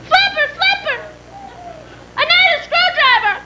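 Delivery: put-on very high child's cry for help; little boy calling. Boy stuck in boat to Flipper, calling high. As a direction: call out to the dolphin, high-pitched.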